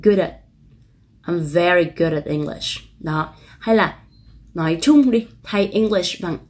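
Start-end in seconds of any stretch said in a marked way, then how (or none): 0.0-0.3 "good at" joined smoothly as one unit; quick linked good at. Shown as a demonstration. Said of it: "nối âm cho mình âm t". 1.2-2.8 slow demo with "good at" joined; teacher model sentence; linked sentence. Shown as a demonstration. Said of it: "nối âm".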